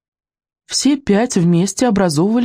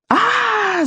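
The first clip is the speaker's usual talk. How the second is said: high sustained "aaah" scream; high-pitched storybook yell. A narrator's reading. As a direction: scream in chorus at a controlled volume. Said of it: "заорали они".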